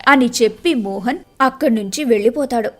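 usual speaking voice

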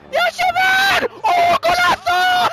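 very high, loud goal yell; goal celebration. Real, not acted. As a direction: roar the goal like a commentator. Scoring a goal.

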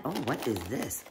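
ordinary talk

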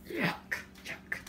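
whispered yuck. Real, not acted. Whispered disgust.

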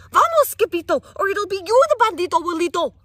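high, loud frantic warning; panicked cry. Acted. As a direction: shout it out and run. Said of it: "they cried".

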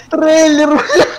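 high excited outburst; excited shout. Real, not acted. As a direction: blurt it out, thrilled. Sudden realization mid-game.

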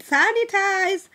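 "Sanitize" high and drawn out; emphatic warning. Real, not acted. Repeats the word as a warning.

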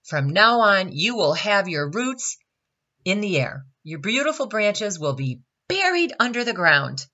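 stern sentence. A narrator's reading. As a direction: hand down a punishment sternly. Sun pronounces the tree's punishment.

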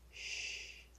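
a hushed shh to the dog; low soft hush. A narrator's reading. Dead man hushes the dog, soft shh. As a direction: finger to lips, quiet shh.

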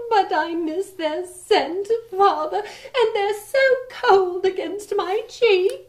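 a daughter mourning her roses; sad young princess. Acted. Princess complaining to her father.

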